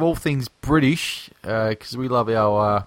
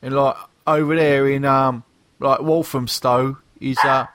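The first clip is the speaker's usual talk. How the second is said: hammed-up British geezer greeting; fake British accent. Podcast host introducing the lads, in a fake British accent. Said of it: "enough of the fake British accents".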